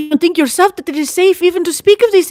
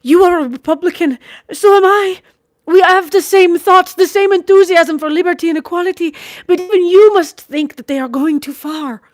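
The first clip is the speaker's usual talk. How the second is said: much higher and louder, passionate outburst; vehement; 9 semitones higher, 8 dB louder than usual. A narrator's reading. Sister arguing politics with her brother, vehemently. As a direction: hurl it at him with fierce conviction. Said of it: "she said vehemently".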